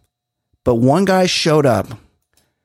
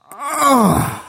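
cringing groan; 10 semitones higher than usual. Real, not acted. Reacting to the smooth line.

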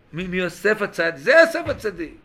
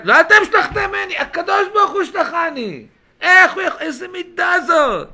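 raised, ringing wonder; impassioned preaching; 11 semitones higher, 8 dB louder than usual. Acted. Preacher voicing Joseph crediting God for sending him, impassioned. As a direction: proclaim it with forceful amazement.

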